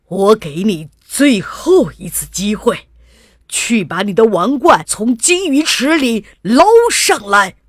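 loud, furious king's ultimatum; angry yelling. A narrator's reading. King giving his daughter one last chance, angry yell. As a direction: bellow a last warning, building in force. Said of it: "国王气得大叫".

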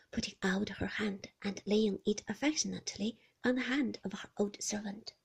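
ordinary delivery

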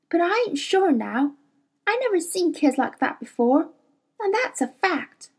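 put-on young girl admitting doubt, understated; girl thinking aloud, mildly; lightly voiced Lyra, troubled. Lyra voiced in held run.

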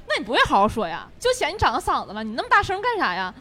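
demo of irritated reply. Shown as a demonstration. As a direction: snap back at him for yelling. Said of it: "你稍微有点激恼".

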